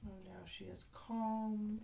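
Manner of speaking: normal talking voice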